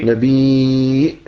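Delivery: نبي with a very long final ee; drawn-out final vowel. Shown as a demonstration. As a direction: stretch the ee of نبي slowly. Teacher sounds out the pattern.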